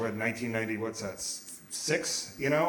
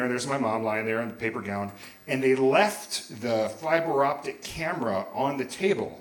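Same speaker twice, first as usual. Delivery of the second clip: usual speaking voice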